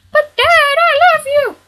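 put-on high childish son voice pleading; whiny son. Son begs his dad for love, high and whiny. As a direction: play the hurt kid begging Dad.

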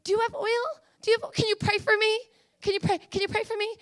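high, needy mock begging; pleading foolish virgin. Unprepared believer asking little Elizabeth for oil and prayer, high pleading. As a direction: beg in a small high voice, repeating yourself. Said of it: "they're gonna go to little Elizabeth".

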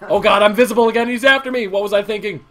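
frantic as the killer gains; being chased. Real, not acted. Fleeing the murderer in game.

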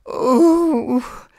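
high, drawn-out groan; queasy little-girl groan. A narrator's reading. Alice groans that she feels sick, high and slow. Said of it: "Alice groaned".

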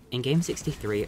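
normal talking voice

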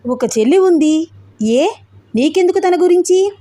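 puzzled, rising pitch; confused question back at the accuser; bewildered. A narrator's reading. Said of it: "అయోమయంగా చూస్తూ అన్నాడు".

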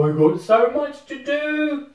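high, loud, stretched-out glee; giddy excitement. Real, not acted. Player greeting all the games, giddily excited. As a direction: let the voice soar with delight.

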